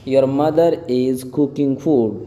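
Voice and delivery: very slow, spaced-out English words; slow model reading. Shown as a demonstration. Teacher translating a sentence for learners, very slowly. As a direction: draw each word out for learners.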